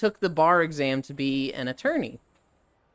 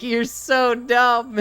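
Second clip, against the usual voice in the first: slow, louder, amused jab; laughing husband; 5 semitones higher, 5 dB louder, much slower than usual. Retold or imitated. Husband laughing at his wife, slow and louder. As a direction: drag it out between laughs. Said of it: "he's just fucking laughing".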